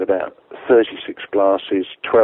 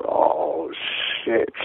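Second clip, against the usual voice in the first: dismayed mutter; 6 dB quieter than usual. Retold or imitated. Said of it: "sat there in the ruins going".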